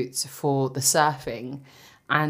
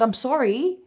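shocked reply; 10 semitones higher, 4 dB louder, slower than usual. Retold or imitated. Retold shocked reply to fitter.

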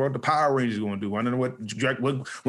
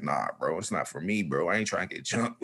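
scared friend's refusal; 6 semitones lower, 5 dB quieter than usual. Retold or imitated.

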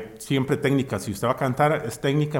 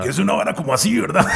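voz de fumador; 4 semitones higher, 3 dB louder, faster than usual. Shown as a demonstration. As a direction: demo of a smoker's backing voice. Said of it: "una voz de fumador a fondo". (voz impostada de fumador)

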